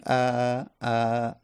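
light, open "ا" as an ah sound; fatha vowel. Shown as a demonstration. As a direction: sound "ا" as ah without working the jaw.

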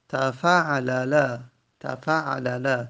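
تفعلل stretched slowly, each syllable clear; slow Arabic pattern. Shown as a demonstration. Teacher showing students the four-letter pattern, slow and careful. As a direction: draw out تفعلل for the class. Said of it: "teacher spells out Arabic pattern".

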